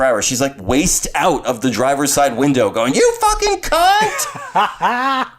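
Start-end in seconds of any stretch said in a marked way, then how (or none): 2.9-5.3 very high, drawn-out mock scream; imitated angry yell. Host imitates mother hanging out car window, high mock yelling. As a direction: mimic mom's road-rage yell, stretching every word. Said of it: "She yelled it out the car window".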